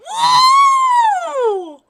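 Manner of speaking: long, high, loud whoop; awestruck shout. Real, not acted. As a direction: holler it in amazement, drawn out. Reacts to huge sword.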